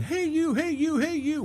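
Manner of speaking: high sing-out imitation of a sergeant's yell; high mock holler without much volume. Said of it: "he's yelling".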